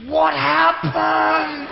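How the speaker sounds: high, drawn-out wail of shock; shocked little boy. Retold or imitated. Little boy gawping at a man without limbs, shocked. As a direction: stretch it out in a high child's cry.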